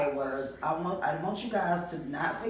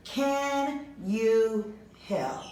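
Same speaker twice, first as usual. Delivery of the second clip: recorded prompt; 4 semitones higher, much slower than usual. Acted. Teacher plays recorded prompt.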